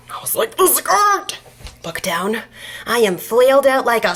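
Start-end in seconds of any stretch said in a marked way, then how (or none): 0.6-1.4 high, loud mock panic; alarmed exclamation. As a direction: cry it out in sudden dismay. Panicked reaction after fainting.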